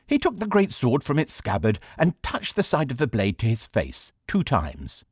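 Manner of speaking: ordinary delivery, nothing marked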